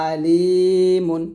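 আলিমুন opening on hamza from the base of the throat, long drawn লি; hamza pronunciation. Shown as a demonstration. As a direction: say আলিমুন with a plain glottal 'a' and a stretched ee. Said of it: "হামজাটা উচ্চারণ করতে হবে".

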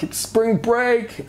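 high, slow, hyped spring-break cheer; party voice. Retold or imitated. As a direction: sell it like a partying student.

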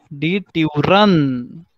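very slow: Türen with rounded ü; teacher modelling the plural; model pronunciation. Shown as a demonstration. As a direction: draw out die Türen. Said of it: "teacher models plural".

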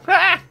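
sharp high loud ha; triumphant ha. Real, not acted. Gamer gloating over a kill, sharp loud ha. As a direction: bark one gloating laugh.

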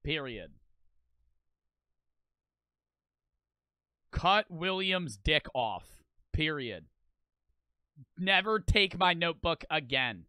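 slow, deliberate dictation; writing it out. Pretend. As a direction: spell out each wish slowly, with pauses. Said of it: "I would write in the book".

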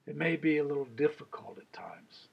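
soft whispered aside; a quiet whispered confession. Real, not acted. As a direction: drop to a hushed whisper. Softened aside to listeners.

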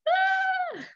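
sudden very high yelp; startled cry. Acted. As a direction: jump at the noise. Startled by the dog.

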